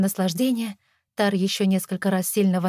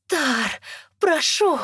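sobbing plea; 7 semitones higher, much slower than usual. A narrator's reading. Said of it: "прорыдала я".

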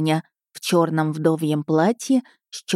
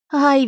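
high-pitched yelp of pain; put-on childish "ow"; boy's squeal; 9 semitones higher, 4 dB louder than usual. A narrator's reading. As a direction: squeal like a caught lad. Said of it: "верещал тот".

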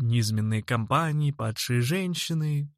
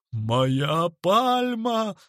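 higher, very drawn-out howl; anguished yell; 5 semitones higher, much slower than usual. A narrator's reading. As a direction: bawl over a ruined treasure. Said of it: "заорал он благим матом". (истошный крик)

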